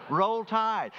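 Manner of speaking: high, loud Roll Tide cheer; team cheer called out. Retold or imitated. Passers-by in Arizona calling to a fan, loud cheer. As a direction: shout it like a rival fan passing by. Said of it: "they would say one of two things".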